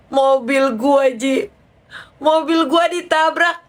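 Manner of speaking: high, slow, whiny lament; wailing friend. Retold or imitated. Friend reports her crashed car to the speaker, wailing. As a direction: draw it out like a distressed caller.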